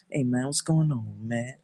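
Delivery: soft, far-back tough-guy imitation; cool tough boyfriend. Boyfriend coming to question him, imitated cool tough guy. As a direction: drop the volume, play the cool enforcer.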